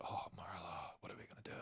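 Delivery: hushed, anxious whisper; whispered worry. Retold or imitated. Retelling a whispered call to Marla, hushed. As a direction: whisper it like a secret dread.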